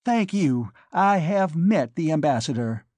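anger barely held in; restrained anger. A narrator's reading. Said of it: "shaking with restrained anger".

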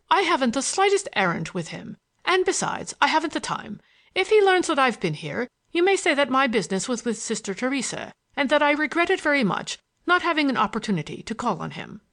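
haughty boss waving away a suggestion; impatient schemer, dismissive. Acted. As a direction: raise the pitch and brush it off. Pickering refuses Bates's suggestion.

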